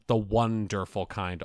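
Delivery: slowly milk the lame pun "the wonderful kind"; mocking the pun. Shown as a demonstration. Cohost reads out the lame card pun, slowly and mockingly. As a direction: draw out "the wonderful kind" slowly with a falling end. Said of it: "enfeebled pun".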